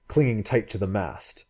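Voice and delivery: plain reading voice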